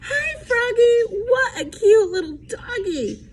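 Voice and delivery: put-on girl frog greeting Froggy and his dog, high and sweet; Frogalina voice. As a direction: coo over the puppy. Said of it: "along came Frogalina".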